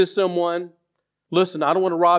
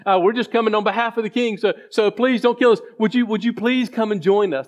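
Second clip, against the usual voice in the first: higher, begging voice of frightened soldiers; pleading soldiers; 5 semitones higher than usual. Retold or imitated. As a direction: plead with the prophet not to kill you. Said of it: "They were a little bit more respectful".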